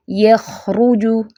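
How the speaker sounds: यखरुजु slow, with a long streaming kh; flowing kh. Shown as a demonstration. Teacher shows the sound carrying on, slow flowing kh. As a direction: let the kh in यखरुजु keep sounding. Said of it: "आवाज़ चलेगी".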